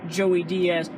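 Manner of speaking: usual speaking voice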